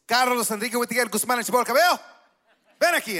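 mock parental summons, fast and high; scolding father. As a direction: imitate dad calling you out in Spanish. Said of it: "whenever I'd get in trouble".

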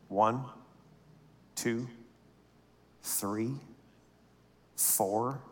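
slow, low, soft count with long pauses; suspenseful, quiet count; hushed counting. Retold or imitated. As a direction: count each bill out under your breath. Counting out hundred-dollar bills.

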